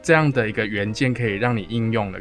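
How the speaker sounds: ordinary talk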